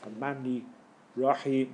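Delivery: slightly deep, soft "Rahman Rahim"; deep recitation. Shown as a demonstration. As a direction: let it sit low and relaxed. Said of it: "Gotta be deep".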